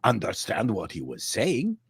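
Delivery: put-on light French accent on English; Parisian French accent. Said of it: "he spoke like this".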